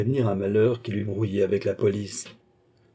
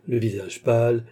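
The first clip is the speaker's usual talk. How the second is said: usual speaking voice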